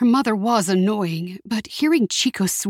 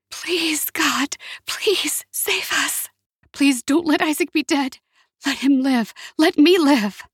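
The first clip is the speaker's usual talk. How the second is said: desperate prayer; 7 semitones higher than usual. A narrator's reading. Jessica prays to God amid gunshots, desperate prayer. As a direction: beg in a whisper that rises into a cry. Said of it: "Jessica prayed silently".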